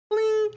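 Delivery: very high, sustained "bling"; ping like a phone alert in a high, held note; imitated notification chime.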